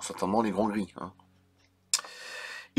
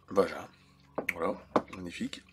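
dry sarcastic mutter, much softer; sarcastic aside; 5 semitones lower, 7 dB quieter than usual. Real, not acted. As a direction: mutter it with irony. (murmure sarcastique)

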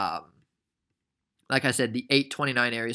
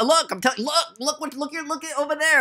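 excited urgent pointing; 13 semitones higher than usual. Acted. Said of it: "I'm like".

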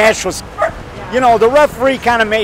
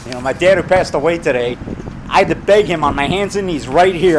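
normal talking voice

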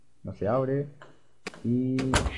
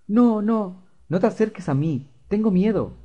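put-on higher, frightened little boy pleading; scared child voice; 9 semitones higher, 5 dB louder than usual. Fluffy to David, frightened higher child voice. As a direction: play the small boy backing away in fear.

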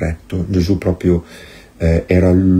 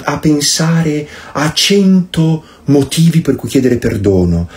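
mock groveling apology. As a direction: whine the apology like a spineless people-pleaser. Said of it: "noi avremmo detto".

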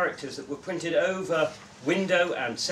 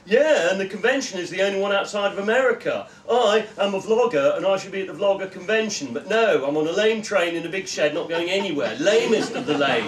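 put-on higher, louder bratty girl voice; whiny girl; 5 semitones higher, 8 dB louder than usual. Sulky vlogger girl complains to the man, higher and whiny. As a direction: whine like a bored teen.

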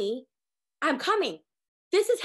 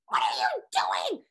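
freaking out; 7 semitones higher, slower than usual. Retold or imitated. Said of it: "even when you're freaking out".

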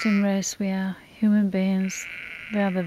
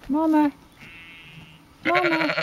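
mock calling; 8 semitones higher than usual. Imitating the cow calling babies.

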